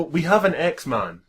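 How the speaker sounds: usual speaking voice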